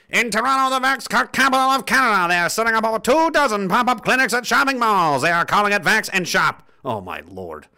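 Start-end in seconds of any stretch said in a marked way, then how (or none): 0.1-6.7 high-pitched, projected announcer voice; put-on announcer read. Reads news item in put-on voice.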